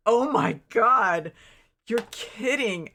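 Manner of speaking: shocked disbelief. Retold or imitated. Streamer reenacts hearing about twenty relatives to hug, stunned. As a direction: drag out the shock like you can't believe it. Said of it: "I'm just looking at her like".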